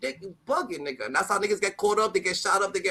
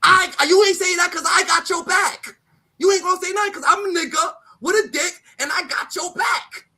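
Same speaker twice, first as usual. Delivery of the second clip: high, loud, insistent shouting; heated shout; 7 semitones higher, 6 dB louder than usual. Real, not acted. Shouting to a friend that he has her back. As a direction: yell it like you mean every word.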